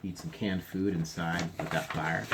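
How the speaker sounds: ordinary delivery, nothing marked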